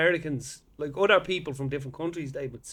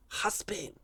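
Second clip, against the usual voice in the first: put-on husband said with a Spanish accent, huspin; 3 semitones higher, 11 dB quieter than usual. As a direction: mimic a Spanish speaker saying husband. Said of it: "Spanish people".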